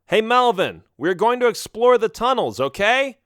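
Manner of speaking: high, carrying shout; yelling. Acted. Boy tells Melvin about exploring tunnels, yelling. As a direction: call it up high through the boulders. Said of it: "and yelled".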